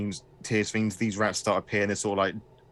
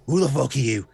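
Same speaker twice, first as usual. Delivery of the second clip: menacing pig creature; 5 dB louder, faster than usual. Acted. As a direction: bark it at the intruder down the barrel. Pig creature holding gun.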